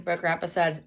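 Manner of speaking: usual speaking voice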